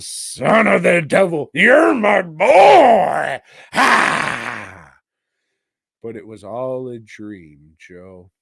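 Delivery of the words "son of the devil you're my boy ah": very loud, higher, slow villain reveal; hammy devil. Retold or imitated. The devil telling his son the truth, hammed-up shout. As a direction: ham up the devil's big reveal.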